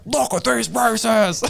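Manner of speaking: high, slow, hammed-up accusation; mocking accuser. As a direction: sneer it like a self-righteous critic. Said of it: "who's gonna be like".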